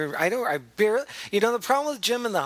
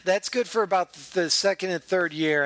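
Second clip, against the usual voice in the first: ordinary talk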